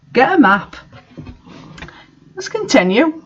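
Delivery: a reader interrupting the story to correct it; exasperated aside. Real, not acted. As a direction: roll your eyes and lecture the listener. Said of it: "reader breaks off to comment".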